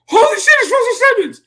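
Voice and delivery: high, loud, fast mock freak-out; astonished outburst. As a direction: blurt it out in starstruck shock. Acts out seeing star up front.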